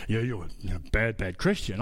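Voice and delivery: low, mocking preacher voice; imitating a condescending preacher; mock preachy judgment. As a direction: sneer it like a smug judge wagging a finger. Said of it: "I'm not sitting there going".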